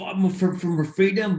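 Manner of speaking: high-pitched mock rallying cry; William Wallace. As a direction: do a rough attempt at a film hero. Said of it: "William Wallace is like".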